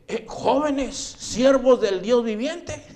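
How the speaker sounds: high, loud hail with drawn-out words; calling out. Retold or imitated. Alarmed king to the youths in the fire, calling out. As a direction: call into the furnace as an amazed king, acted. Terrified king calls into furnace.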